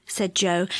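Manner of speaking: plain reading voice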